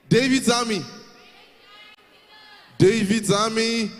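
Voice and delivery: high, loud shout to the crowd; loud hype-leader call; rallying call. Real, not acted. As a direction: call the troop to attention, stretching the repeat. Host calls out to rally crowd.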